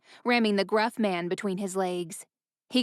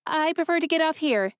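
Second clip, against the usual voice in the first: Ella; 8 semitones higher than usual. A narrator's reading. Ella insists to the driver.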